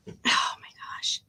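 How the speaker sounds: breathy whispered oh my gosh; shaken whisper. Real, not acted. Rattled by the cards, whispering to herself shakily. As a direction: barely voice it, rattled. Spooked during tarot reading.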